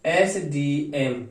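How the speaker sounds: एसडीएम said very slowly, each letter separate. Shown as a demonstration. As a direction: spell out एसडीएम slowly for the class. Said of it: "अंग्रेजी में direct ऐसे बोलूं". (एसडीएम को धीरे-धीरे अक्षर-अक्षर बोलें)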